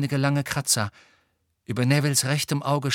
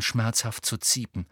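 usual speaking voice

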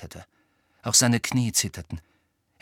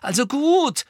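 high, loud, nervy resolve; anxious but resolute; 7 dB louder than usual. A narrator's reading. Hermine gathers courage before Harry, anxious yet determined. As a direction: give in and brace to speak up. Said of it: "sagte sie ängstlich, aber entschlossen".

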